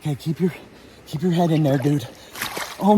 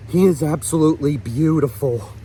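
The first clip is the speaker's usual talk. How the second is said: usual speaking voice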